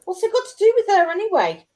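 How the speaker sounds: indignant retort. Real, not acted. Repeated louder indignant retort.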